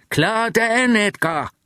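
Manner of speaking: high, ringing call across the airport; bright parting shout, pitched high. Acted. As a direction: shout the goodbye cheerfully to a friend. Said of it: "råbte han".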